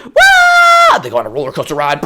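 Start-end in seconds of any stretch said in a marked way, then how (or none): 0.0-1.0 mock wail. Retold roller coaster drop.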